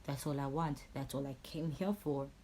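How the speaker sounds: normal talking voice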